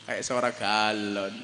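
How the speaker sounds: deadpan low aside; mock low voice. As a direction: drop the voice low and slow for the joke.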